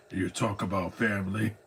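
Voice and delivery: imitating the Batman voice that sounds like Vin Diesel; Batman impression. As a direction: put on the deep superhero voice. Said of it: "Jeremy Sisto doesn't sound like Jeremy Sisto".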